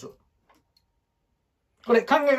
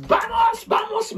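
high chant of バモス バモス; rally the team with a high バモス; playful Spanish-style rallying call; mock Spanish cheer.

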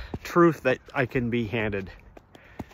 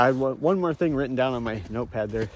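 ordinary delivery

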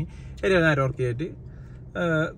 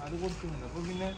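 hushed; 6 dB quieter than usual. Real, not acted. Baby asleep in car.

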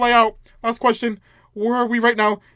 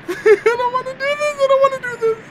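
very high whimpering plea; dreading whine; 4 dB louder than usual. Real, not acted. Player dreading the next room, high dreading whine. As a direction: whine it high through nervous laughter.

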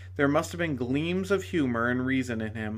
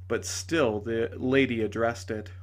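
ordinary delivery

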